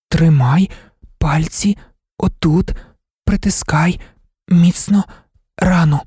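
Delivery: high monotone, word ... by ... word; unmodulated, choppy speech. A narrator's reading. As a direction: deaf woman speaking in separate, evenly pitched words. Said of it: "Вона вимовляла немодульовано й уривчасто".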